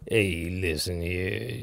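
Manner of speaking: slow, low, soft Southern drawl; country drawl imitation. Host imitates the caller's voice in a slow, low Southern drawl. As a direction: drawl it like an old country man. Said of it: "sounds a little bit like this".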